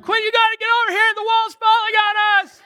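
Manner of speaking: high-pitched, rushed yell; acted-out frantic high shout; retold panicked scream. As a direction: scream an urgent warning at your wife. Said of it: "I screamed at Quinn".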